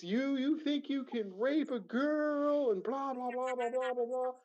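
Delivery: high, slow imitated rant; mock screaming. Intruder accusing the homeowner, high mock screaming rant. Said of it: "He's screaming".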